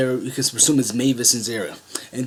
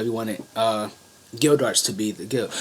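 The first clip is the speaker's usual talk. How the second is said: ordinary delivery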